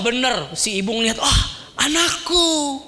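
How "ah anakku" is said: high, weepy cry with a laugh; mother seeing her child again; tearful joy. Acted. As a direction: sob with relief as the mother. Mother sees her lost child.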